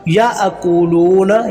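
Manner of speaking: याअकूलूना with a catch after ya and loo held long; jerk and lengthening. Shown as a demonstration. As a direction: slowly recite याअकूलूना, jolt ya, stretch loo. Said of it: "झटके के साथ लाम को लंबा". (याअकूलूना में 'या' झटके से, 'लू' लंबा)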